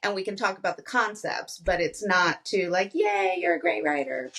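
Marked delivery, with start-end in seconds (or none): 2.9-4.3 high-pitched gushy mock compliment; mock cheering praise. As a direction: gush like an over-keen cheerleader. Example of gushing praise not wanted.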